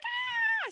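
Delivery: very high imitated child squeal; mock delighted squeal. Little girl reacting to bubbles, imitated high squeal. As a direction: squeal like a thrilled kid.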